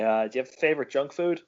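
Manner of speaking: usual speaking voice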